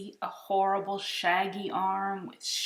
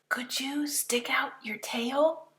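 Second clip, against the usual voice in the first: nervous whisper; 6 semitones higher than usual. Acted. Nervous boy asking the monster, whispering. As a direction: whisper it timidly. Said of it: "I whispered".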